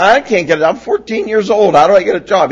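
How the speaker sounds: mock whine to dad; whiny teen. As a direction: protest like a helpless fourteen-year-old. Teen pleading with father.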